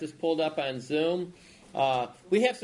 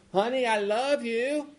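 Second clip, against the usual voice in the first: put-on old wife saying it again, higher and slow; repeating louder; 6 semitones higher, 3 dB louder than usual. Elderly wife repeating herself to her deaf husband, slow and raised. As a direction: drag each word out so he hears.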